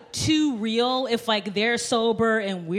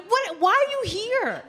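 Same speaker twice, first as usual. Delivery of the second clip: baffled disbelief; 10 semitones higher than usual. Retold or imitated. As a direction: act out exasperated bafflement, pitch soaring. Acting out baffled reaction to strippers.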